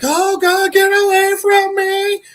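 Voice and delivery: very high-pitched panicked wail, hammed up; mock freaking out. Melvin freaking out amid the Jokers, mock panic. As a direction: squeal it in exaggerated terror. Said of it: "Melvin's freaking out".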